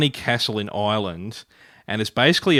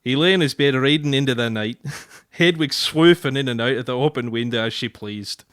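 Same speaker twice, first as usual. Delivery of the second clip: broad put-on Scots reading; Scottish accent. Shown as a demonstration. Husband reading a Scots book excerpt to his wife, Scottish accent. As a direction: read aloud in thick Scottish. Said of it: "putting on the Scottish accent".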